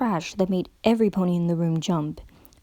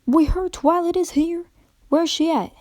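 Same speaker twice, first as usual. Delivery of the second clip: higher, pressing country pony voice; urgent country mare; 8 semitones higher, 3 dB louder than usual. A narrator's reading. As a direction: push to find her friend. Character asks urgently.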